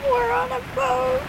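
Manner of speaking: high, thrilled shout of delight; excited squeal. Real, not acted. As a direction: squeal it with pure glee. Player excited on ship.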